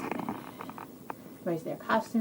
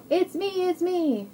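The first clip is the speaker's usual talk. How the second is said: high-pitched happy reveal; excited pig; 9 semitones higher, 5 dB louder, slower than usual. Acted. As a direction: pipe up high as the unmasked pig.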